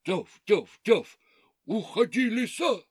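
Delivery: loud barking dogs; barking shout. Acted. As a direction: bark it out loudly. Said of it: "закричала".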